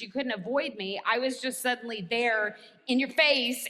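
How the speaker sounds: ordinary delivery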